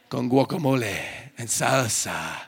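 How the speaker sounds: low, playful Spanish accent; Latin American accent. Shown as a demonstration. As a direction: roll out the menu with a Latin accent. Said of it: "a Latin American accent".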